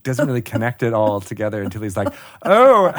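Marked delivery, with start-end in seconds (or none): 2.4-3.0 high, loud "oh" bursting into a long laugh; uproarious laughter. Acted. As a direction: get the joke, then roar with laughter. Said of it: "he laughs uproariously".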